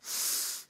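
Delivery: low, soft breathing cue; guided breathing. Shown as a demonstration. Preacher leading a breathing exercise, low meditative voice. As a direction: coach the breath quietly, with pauses.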